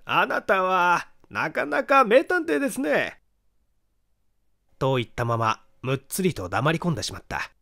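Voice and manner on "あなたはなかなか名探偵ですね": dry, slow, higher ironic compliment; slow sarcastic praise, pitched up. A narrator's reading. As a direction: needle him with mock praise. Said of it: "なんだか皮肉な調子で".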